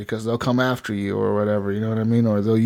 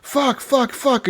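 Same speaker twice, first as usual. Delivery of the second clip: much higher mock-panicked cries; freaked out; 11 semitones higher, much slower than usual. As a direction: imitate a panicked yelp without real volume. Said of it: "he's fucking screaming, he's all freaked out".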